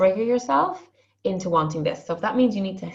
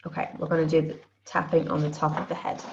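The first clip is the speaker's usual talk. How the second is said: ordinary talk, nothing marked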